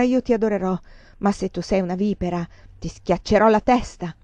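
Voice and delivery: plain reading voice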